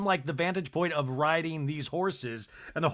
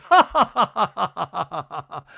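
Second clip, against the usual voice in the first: loud mock cackle; 6 dB louder than usual. Recapper mimics Bronwyn's cackle at Brittany. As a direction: ham up a gloating cackle. Bronwyn's mocking laugh acted out.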